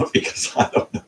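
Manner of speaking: laughing through the words; a self-mocking laugh while talking. Real, not acted. Laughs at own lack of friends.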